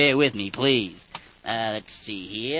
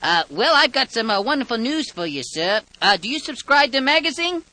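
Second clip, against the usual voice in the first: bright, raised sweepstakes pitch; salesman voice; 9 semitones higher, 4 dB louder than usual. Acted. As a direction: sell the prize like a telemarketer. Prank call as sweepstakes salesman.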